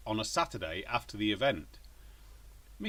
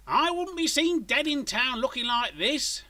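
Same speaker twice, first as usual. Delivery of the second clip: high, loud, indignant wife voice; emphatic announcement; 13 semitones higher, 5 dB louder than usual. A narrator's reading. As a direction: declare it like an offended housewife. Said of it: "she had announced emphatically".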